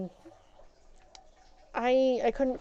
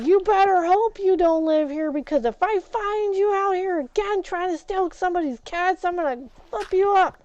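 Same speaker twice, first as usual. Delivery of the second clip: loud, higher menacing warning; threat; 8 semitones higher, 5 dB louder than usual. Retold or imitated. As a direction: warn her off for good. Threatening the thief.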